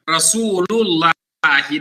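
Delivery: Rasulullahi said slowly, ending on -i; correct ending -i. Shown as a demonstration. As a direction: stretch Rasulullahi, stress the final -i. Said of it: "You don't say Rasulullahu".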